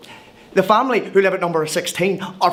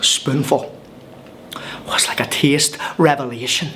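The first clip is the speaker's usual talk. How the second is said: breathy whisper, lower and reverent; first taste of curry, hushed in wonder; hushed awe; 6 semitones lower than usual. Acted. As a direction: whisper it like a sacred moment. Savouring first bite, hushed.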